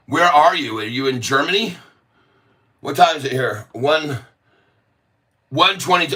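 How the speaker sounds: usual speaking voice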